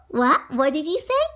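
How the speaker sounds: ordinary delivery, nothing marked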